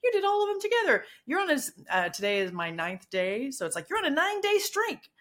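high, cheery app announcer voice; mock upbeat app cheer. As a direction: chirp it like a game congratulating you. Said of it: "and it goes".

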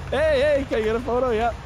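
mock eager, high-pitched request; eager fan. Player calling out for a photo, mock eager.

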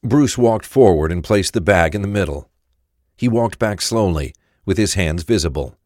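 ordinary talk, nothing marked

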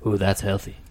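lightly voiced friend impression; Tinez voice. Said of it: "My Tinez voice is like".

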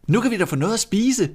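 put-on high, fast, happy little boy's shout; glad råb. A narrator's reading. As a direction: call out in delight at finding food. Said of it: "råbte Hans glad". (glad, lyst råb)

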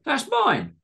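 very high-pitched protest; indignant cry. A narrator's reading. Greg claiming his breakfast back, indignant. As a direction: protest like you've been robbed. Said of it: "cried Greg".

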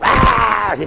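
high mock monster scream; monster cry. The inner monster bursting out at others, mock screaming. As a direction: let out a hammed-up monster "aaah". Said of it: "sale el monstruo".

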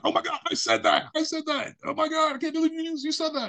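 put-on very high, breathless girlish squeal; squealing with joy. Girlfriend jumping up and down in the mall, squealing. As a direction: shriek it like you can't believe your luck. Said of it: "And she started jumping".

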